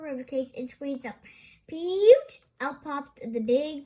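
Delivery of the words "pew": loud high pop sound; popping noise. Acted. Slipper popping out of the dog, a loud pop.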